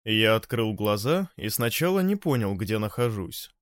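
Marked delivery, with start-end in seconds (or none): none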